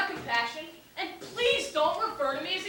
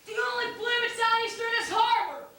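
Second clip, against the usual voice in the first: exasperated yell; 6 semitones higher than usual. Acted. Traveler fed up with the sailors' questions, yelling. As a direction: yell it across the harbor. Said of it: "No need to yell".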